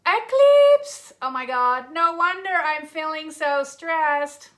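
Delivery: loud, slow, hammed-up American accent; bad American accent. Host jokes to viewers about eclipse stress, bad American accent. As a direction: belt it out in a mock American drawl. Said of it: "put on a bad American accent".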